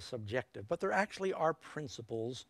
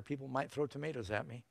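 usual speaking voice

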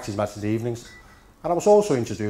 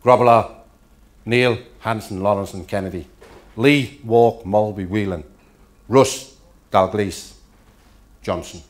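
manager reading team; 7 dB louder, slower than usual. Retold or imitated. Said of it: "he goes".

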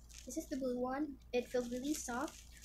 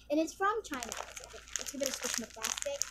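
usual speaking voice

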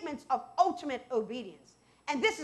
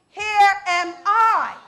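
slow declaration; 5 semitones higher, 8 dB louder, much slower than usual. Acted. Congregant answering God's call.

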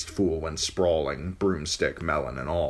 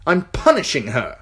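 put-on petulant boy king; 9 semitones higher, 8 dB louder than usual.

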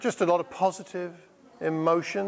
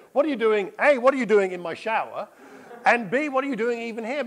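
astonished questioning; 6 semitones higher than usual. Retold or imitated. Questioning the surprise visitor.

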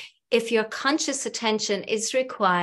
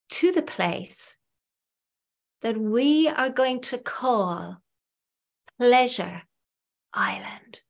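guided visualization. Acted. Hypnotherapist guiding listeners to picture their ideal clients, guided visualization. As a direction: lead the listener gradually inward, phrase by phrase, pausing long between.

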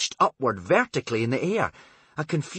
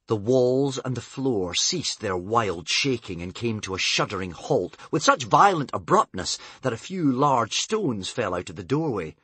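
ordinary delivery, nothing marked

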